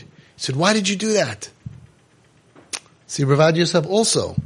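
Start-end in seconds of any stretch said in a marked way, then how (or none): 0.6-1.5 imitated annoyed father, pitched higher; higher-voiced mock reproach. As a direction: scold your son in mild annoyance. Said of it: "My father got very annoyed".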